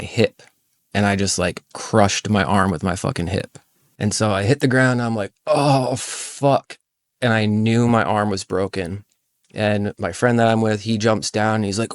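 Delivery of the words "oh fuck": high, strained pained oath; pained curse. Retold or imitated. Falling onto concrete, cursing in pain. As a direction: swear as the bone breaks. In pain after breaking arm.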